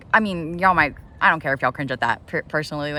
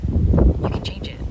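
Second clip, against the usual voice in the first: emphatic whisper; 6 dB louder, slower than usual. Real, not acted.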